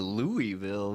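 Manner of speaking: Louisville mocked as "LOO-ee-vil"; mocking mispronunciation. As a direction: make fun of Louisville with a drawn-out EE. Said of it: "They will make fun of you".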